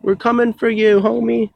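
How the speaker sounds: high-pitched mock bro voice; squeaky imitation of a chill bro; frat bro. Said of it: "We'd be like".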